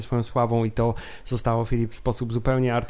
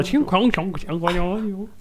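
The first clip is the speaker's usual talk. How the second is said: high-pitched sing-song fake Chinese syllables; mock Chinese gibberish; 7 semitones higher, much slower than usual. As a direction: imitate made-up Chinese chatter, pitched up. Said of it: "mój mózg mi mówi". (udawany chiński bełkot)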